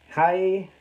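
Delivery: usual speaking voice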